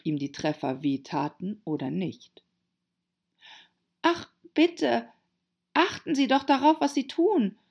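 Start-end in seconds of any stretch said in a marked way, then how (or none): 4.0-7.6 hohes, flehendes Rufen; entsetzter Ruf. A narrator's reading. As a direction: mit hoher Stimme entsetzt bitten. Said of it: "rief Alice, die von Entsetzen gepeinigt". (leicht gespieltes Entsetzen, hoch)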